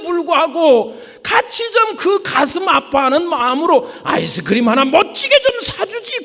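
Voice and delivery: high, loud crying shout; preacher playing the sobbing child; mock wailing shout. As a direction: wail as the boy denied ice cream.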